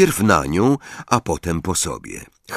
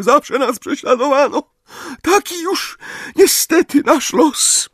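dramatic breaking cry; 3 dB louder than usual. A narrator's reading. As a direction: wail it like a tragic heroine. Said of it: "dramatycznym, załamującym się głosem". (dramatyczny, łamiący się głos)

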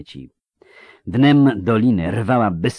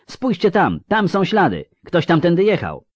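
urgent high, raised call; przekrzykiwanie wichury; 11 semitones higher, 5 dB louder than usual. A narrator's reading. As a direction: shout the discovery over the gale. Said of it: "przekrzykując wichurę". (podniesione wołanie przez wichurę)

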